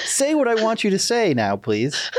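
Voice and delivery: high-pitched, playful threat; mock threat. Host jokingly orders his guests about, mock threat. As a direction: demand it in a put-on high voice. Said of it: "That sounded like a threat almost".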